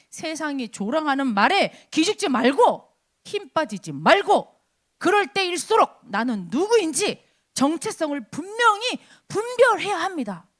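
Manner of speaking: normal talking voice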